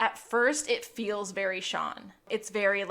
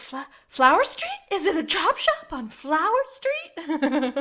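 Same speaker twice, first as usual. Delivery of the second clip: high, louder double take; mock disbelief, pitched way up; incredulous question; 7 semitones higher, 5 dB louder than usual. Acting out chief's startled question.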